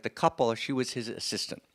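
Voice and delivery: ordinary delivery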